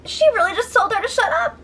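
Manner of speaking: stunned, rising question; incredulous. Real, not acted. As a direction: pitch jumps way up in disbelief. Reacting in disbelief to the scene.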